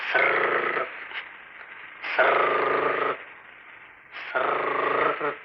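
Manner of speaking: long slow unvoiced sarr hiss; rustling leaves sound. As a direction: mimic feet crushing dry leaves. Imitates rustling dry leaves. (சருகு சரசரப்பு ஒலி)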